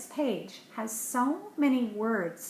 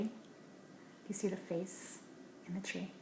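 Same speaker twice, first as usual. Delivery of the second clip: whisper; 13 dB quieter than usual. Real, not acted. Parent showing a hidden face in a tree, whispered. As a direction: hush and point it out.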